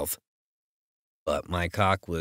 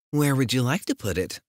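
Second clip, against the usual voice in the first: lightly voiced heroine; 7 semitones higher than usual. Heroine teases him.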